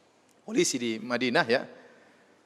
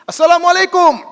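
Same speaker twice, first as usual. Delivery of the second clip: shouted greeting, much higher and louder; 12 dB louder than usual. Retold or imitated. Police chief greeting the idol in the temple, shouting. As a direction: call the greeting out at full volume. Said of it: "dia teriak keras".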